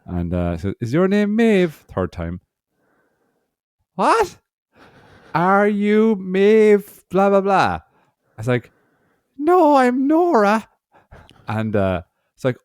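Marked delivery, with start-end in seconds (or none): 0.8-1.7 high clerk voice. Retold or imitated. Clerk calling name, voiced. 3.9-7.8 put-on high, slow, confused old woman; old lady voice. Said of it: "I'm gonna do an old lady voice". 9.4-10.7 put-on high, slow old woman; a slow, high old lady. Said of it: "I'm gonna do an old lady voice".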